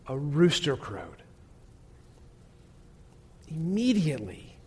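somber sermon storytelling. Acted. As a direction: draw the listeners into the moment of sorrow. Somber retelling of Peter's failure.

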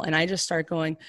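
usual speaking voice

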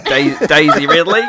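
loud, questioning stab at Australian; attempted Aussie accent. Host trying the name on request, loud mock Aussie accent. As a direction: try out Aussie with a laugh, rising at the end. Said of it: "wants me to do an Aussie accent".

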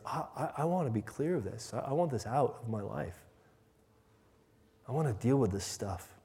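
soft, heartfelt plea; hushed, sincere longing to change; earnest repentance. Pretend. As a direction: confess quietly, stammering into resolve.

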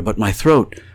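plain reading voice

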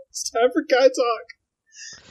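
high, slow hammed-up intro; drawn-out cheesy announcer intro; mock show announcer. Introducing the guys' chat like a cheesy TV host.

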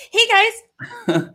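high-pitched cutesy mock greeting. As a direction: put on a high, chirpy imitation. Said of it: "that's how they walk".

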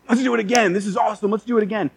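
put-on high, eager child's voice, all in a rush; excited kid. Nephew to his uncle after the jump, excited. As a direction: imitate a thrilled little boy.

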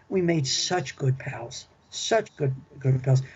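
ordinary talk, nothing marked

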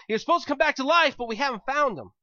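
high, loud, hurried protest; acted exasperation. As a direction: blurt it out like a frustrated follower. Acting out disciples' exasperated answer.

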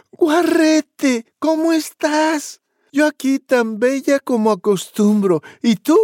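voz de niña muy aguda y fuerte; Little Red on phone. Acted. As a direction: habla coqueta como Caperucita. Caperucita habla con el cerdito. (Caperucita saluda al cerdito con voz muy aguda)

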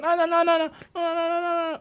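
high, rapid repeated no; ululating no. Retold or imitated. Woman rushing over to stop climbing, ululating. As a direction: wail no over and over.

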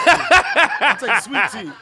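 drawn-out mock order.

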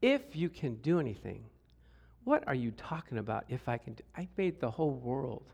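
low, soft incredulous retort; quietly offended, stressing the doubted words; affronted disbelief. Acted. Jesus incredulous at being doubted.

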